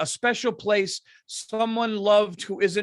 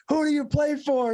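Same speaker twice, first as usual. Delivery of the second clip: coach bark; 7 semitones higher than usual. Retold or imitated. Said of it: "fucking go up and down".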